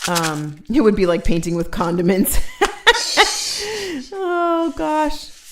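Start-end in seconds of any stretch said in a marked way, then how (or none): 2.4-4.1 high laugh. Real, not acted. Laugh alone.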